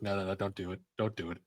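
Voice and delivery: soft, low muttered self-restraint; hushed self-talk. Retold or imitated. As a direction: quietly warn yourself off the temptation. Acting out tempted inner self-talk.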